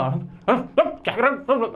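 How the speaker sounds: mock sleep-talker's mumble; imitating sleep-talk mumbling. Teacher showing how people talk in sleep, mock mumbling. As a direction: babble as if talking while asleep. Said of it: "बड़बड़ा रहे हैं".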